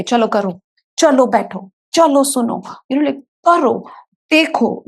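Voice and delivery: put-on an adult pushing a child past their limits, escalating; bossy commands.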